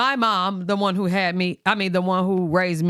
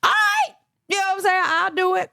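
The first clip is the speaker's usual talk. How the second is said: squeaky high "all right"; reluctant agreement; 13 semitones higher than usual. Retold or imitated. Son agreeing to his mom in a high nervous squeak. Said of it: "So I'm like".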